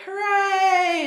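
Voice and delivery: loud, high mock cheer; mock cheering hooray. The characters cheer as they land safely, loud high mock cheer. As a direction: ham up a crowd's relieved cheer.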